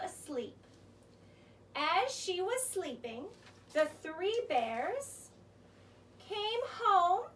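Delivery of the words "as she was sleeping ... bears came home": ordinary delivery, nothing marked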